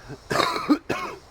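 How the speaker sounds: harsh hacking cough; rough chesty cough fit; smoker's cough. Real, not acted. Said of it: "Lovely Corona and smoker's cough".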